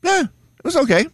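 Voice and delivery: high-pitched mock shrug of a review; unimpressed Steve. Teasing Steve about his review of everything, mock indifference. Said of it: "Steve's review of every single thing".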